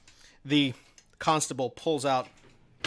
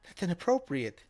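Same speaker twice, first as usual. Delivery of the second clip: flustered and high-pitched, a little scandalized; blushing protest; 4 semitones higher than usual. Acted. Amy, flustered, protesting the guard's insinuation. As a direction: protest primly while blushing. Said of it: "Amy just kind of blush".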